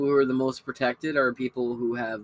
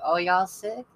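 much higher, slow Southern granny voice; sweet Southern old lady; 10 semitones higher, slower than usual. Retold or imitated. Ninety-four-year-old Lily offering her sick roommates soup, high Southern coo. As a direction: coo like a kindly Southern grandmother. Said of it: "So now she's like".

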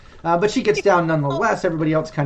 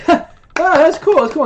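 high, loud delighted onlooker; wowed spectator; impressed bystander; 8 dB louder than usual. Acted. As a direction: gasp it out like a passer-by who loves the trick. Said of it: "people actually are like".

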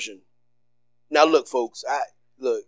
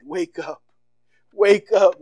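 ordinary talk, nothing marked

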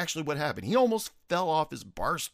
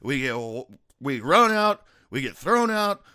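Irish brogue; 8 semitones higher, 7 dB louder than usual. Retold or imitated. Irish bar patron explaining the joke to the bartender, loud Irish accent. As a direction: boom it out in a thick Irish accent. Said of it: "his Irish drove".

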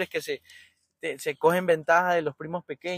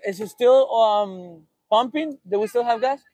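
loud, higher call across the pumps; 4 semitones higher, 8 dB louder, slower than usual. Real, not acted. Driver in gas line calling to the attendant, loud call. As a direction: shout the question over to the attendant.

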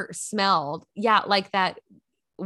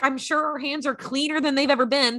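dramatic gasp; 6 semitones higher than usual. Retold or imitated. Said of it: "my mom and I both were like".

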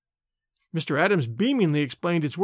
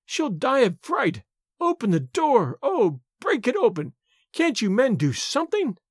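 frantic wailing; 6 semitones higher than usual. A narrator's reading. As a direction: wail in panic for her child. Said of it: "wailed the mother".

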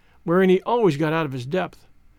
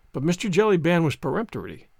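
usual speaking voice

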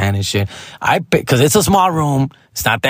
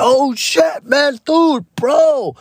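much higher, hyped goofy voice; silly Joy Coy; much slower than usual. Retold or imitated. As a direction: play him as a hyped goofball. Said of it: "Joy Coy being fucking, you know, silly ass".

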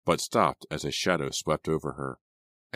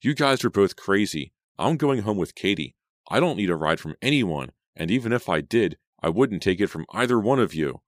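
angry; 5 semitones higher, 4 dB louder than usual. Acted. Selena furious at both boys.

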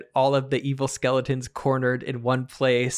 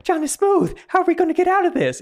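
much higher, fast panicky pleading; panicked wife; 4 dB louder, faster than usual. Acted. HOA agent begs her husband for rescue, high and fast. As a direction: squeal it out, trapped and scared. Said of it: "And she's like".

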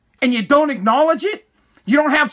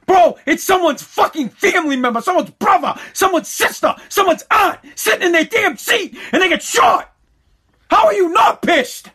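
furious shout; 6 semitones higher than usual. Real, not acted. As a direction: yell it, outraged. Furious rant about killed cops.

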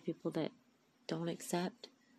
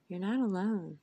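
louder, falling, hitting NOT; loud emphatic; 2 semitones higher, 7 dB louder than usual. Real, not acted. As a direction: proclaim it loudly to the listener. Loud repeated reassurance.

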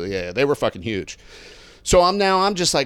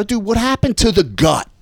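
higher-pitched buddy ribbing; retold teasing; 6 semitones higher, 3 dB louder than usual. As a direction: rib him at a brisk, raised pitch.

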